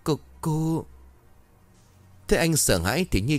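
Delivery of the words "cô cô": stammering. A narrator's reading. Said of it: "Anh liền lắp bắp".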